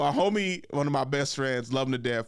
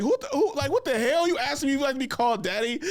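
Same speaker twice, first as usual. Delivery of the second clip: brother's outrage; 9 semitones higher than usual. Retold or imitated.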